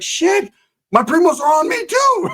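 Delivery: very high, comic wail; mock panicked cry. Narrator answers his friend mid-brawl, high mock wail. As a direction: squeal it in exaggerated panic.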